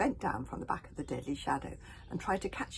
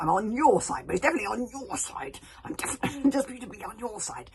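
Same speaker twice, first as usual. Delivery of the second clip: louder, higher desperate insistence; rasped pleading; 6 semitones higher, 5 dB louder than usual. Acted. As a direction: insist you're on her side. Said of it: "he rasped".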